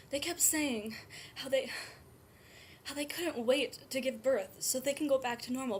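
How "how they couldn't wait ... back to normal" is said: ordinary delivery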